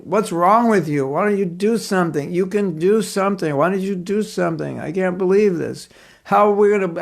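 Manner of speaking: high, reproachful cry to Krishna; angry complaint. Retold or imitated. Devotee reproaching Krishna for a friend's suffering, mock-angry. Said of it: "She's becoming angry with Krishna".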